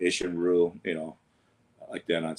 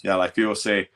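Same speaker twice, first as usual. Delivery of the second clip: ordinary delivery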